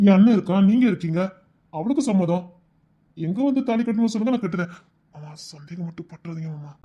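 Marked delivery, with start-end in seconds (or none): 5.1-6.7 much softer whispered plea; pleading whisper. Acted. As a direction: beg him in a hush. Pleading whisper to father-in-law.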